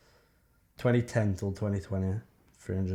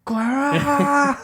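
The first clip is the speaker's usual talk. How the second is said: much higher, much louder shouted call; commentator shout; 10 dB louder than usual. Retold or imitated. Recreating the Aguero goal call for a friend, shouted. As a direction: scream it like the famous goal commentary.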